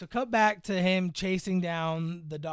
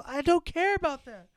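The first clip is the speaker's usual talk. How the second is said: mock earnest reply; 8 semitones higher than usual. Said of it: "And she goes".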